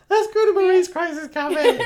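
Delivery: put-on high, squealy drunk suggestion; giggling high drunken squeal; drunken excitement. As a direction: pitch it up like a tipsy friend with a great idea. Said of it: "I was like".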